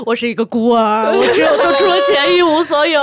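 loud, high mock outburst; hammed-up tearful-style confession; confessing under compulsion. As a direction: blurt it out fast and loud, half laughing. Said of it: "韦恩就在说".

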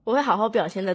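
ordinary talk, nothing marked

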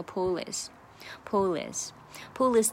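"pull" joined straight onto "is"; slow "pull is" said as one word; linked "pull is". Shown as a demonstration. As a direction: slide the L of "pull" into "is". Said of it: "很重要的连读".

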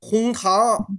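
ordinary delivery

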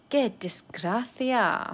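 slow, drawn-out qué desgracia with a sigh; slow sighing repeat. Shown as a demonstration. Teacher sighs over bad luck: slow and drawn out. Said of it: "slower repeat".

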